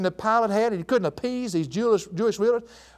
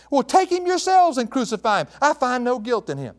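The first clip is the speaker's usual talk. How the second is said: higher-pitched exasperated sarcasm; sarcastic and upset; 6 semitones higher than usual. Acted. Preacher reads Pilate answering the crowd, sarcastic and upset. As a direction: throw it back at them, annoyed. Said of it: "he's really sarcastic and upset".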